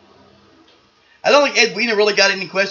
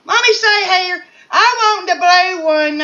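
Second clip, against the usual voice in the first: high-pitched, drawn-out character voice; high put-on contestant; 6 dB louder than usual. [name] choosing among bachelors, high put-on voice. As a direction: ponder the choice in a high silly voice.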